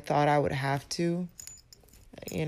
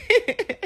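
high, loud giggle; laugh; 8 dB louder than usual. Real, not acted. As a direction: laugh at your own fumble. Laughs at own fumbled phrase.

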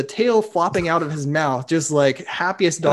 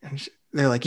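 ordinary talk

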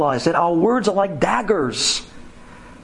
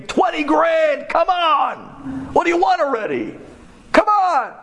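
pleading pressure; 12 semitones higher than usual. Retold or imitated. Bullies begging the victim to accept twenty grand. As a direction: push him hard to take the money.

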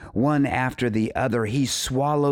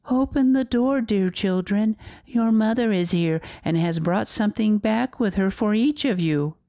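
high-pitched fake mother voice; wolf's softened voice; 11 semitones higher than usual. A narrator's reading. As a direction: coax as a wolf posing as mother, high and sweet. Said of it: "made his voice soft with it".

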